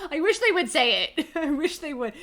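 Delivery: real laughter breaking into the words; laughing exclamation. Real, not acted. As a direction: exclaim it while cracking up. Cracking up at the book.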